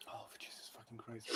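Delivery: breathless whispered oath; real exhausted whisper. Real, not acted. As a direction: drop to a whisper, spent. Exasperated aside while laughing.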